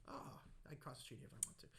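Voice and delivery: whispered, cocky comeback; whispered comeback to two guys staring; defiant whisper. Retold or imitated. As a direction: hiss it back under your breath. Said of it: "so I'm like".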